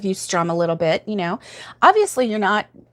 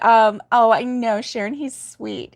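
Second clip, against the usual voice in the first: ordinary delivery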